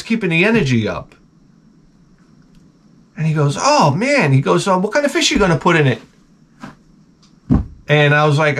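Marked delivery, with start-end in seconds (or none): 3.6-6.0 eager clerk. Retold or imitated. Excited clerk voiced in story.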